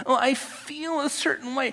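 high, whiny mock complaint; mocking whine. Preacher mocks people ruled by feelings, high whiny parody. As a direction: parody a self-pitying feeler.

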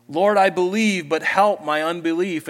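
loud, high fervent prayer cry. Acted. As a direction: call it out to God as your own prayer. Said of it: "That sounds like my prayer often".

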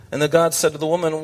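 usual speaking voice

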